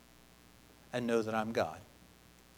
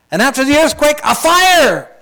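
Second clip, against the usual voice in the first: loud proclaiming; 9 semitones higher, 8 dB louder than usual. Acted. Building Scripture drama.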